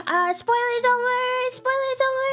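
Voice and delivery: silly announcer. Acted. Streamer telling chat the spoiler is done, high cartoon call. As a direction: sing it out like a goofy herald.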